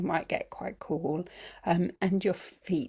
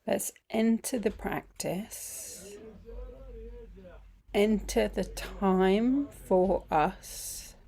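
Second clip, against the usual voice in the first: guided meditation; 5 dB quieter than usual. Acted. Teacher easing the group into feeling the body, guided meditation. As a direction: guide them gently through it, phrase by unhurried phrase.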